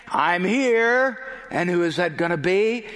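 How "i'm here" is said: raised, higher calling voice; acted shout. Law announcing himself across the campus, acted shout. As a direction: call it out across a distance. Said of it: "you'll hear a shout from across the campus".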